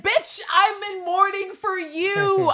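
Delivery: high, loud mock outburst, first words drawn out; Villanelle's exasperated outburst. Voicing the assassin confronting her oblivious rival, exasperated outburst. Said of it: "she's like".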